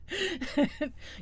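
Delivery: short high chuckle; quick real giggle. Real, not acted. Vlogger amused by the Finding Nemo comparison, short high chuckle.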